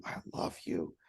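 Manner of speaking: the third "I love you" whispered tenderly, voice nearly gone; fading to a whisper. Retold or imitated. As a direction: let the last "I love you" fall to a hush. Dad's loving words, fading.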